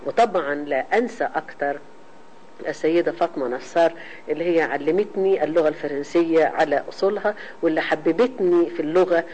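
ordinary talk, nothing marked

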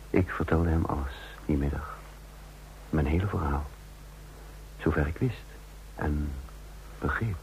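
measured, reflective storytelling voice; calm first-person narrator; story narration. A narrator's reading. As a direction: tell it looking back, unhurried. Narration of the confession afternoon.

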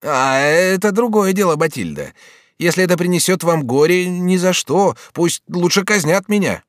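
very high, loud refusal; impassioned Bonifas. Acted. Bonifas refuses to bring Batilda grief, impassioned. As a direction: protest hotly, pitch soaring.

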